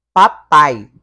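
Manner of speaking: "Papai" loud, pa-pai, with a and i joined; "Papai" punched out, final ai as one glide; syllable demonstration. Shown as a demonstration. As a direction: sound out "Papai" in two firm syllables. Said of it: "O A fica junto com o I".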